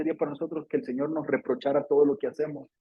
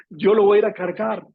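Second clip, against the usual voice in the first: louder, firmer Yo lo voy a ir a cargar; determined vow; 4 semitones higher, 6 dB louder than usual. Acted. Mary insisting to the gardener she will carry him: loud determined vow. Mary's vow, repeated stronger.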